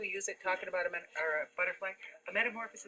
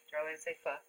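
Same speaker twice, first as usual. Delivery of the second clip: usual speaking voice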